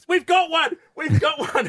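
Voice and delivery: high, excited cry of discovery; gleeful alarm cry; excited shout. Retold or imitated. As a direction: shout it like you've caught one. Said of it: "they went".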